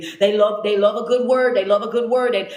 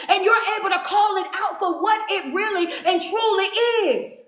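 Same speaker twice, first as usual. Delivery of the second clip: fired-up preaching; 6 semitones higher than usual. Acted. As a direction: preach it hard at the tempter, climbing. Preaching at full force.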